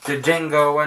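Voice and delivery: Django with the silent D sounded as an extra beat, "duh-JANG-go"; mispronounced Django. Shown as a demonstration. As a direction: slowly misread Django, pronouncing its D separately. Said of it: "used to think this is pronounced".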